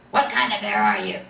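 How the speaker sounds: low, gruff bear voice; a deep gruff grumble of a big bear. A narrator's reading. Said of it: "a gruff voice asked".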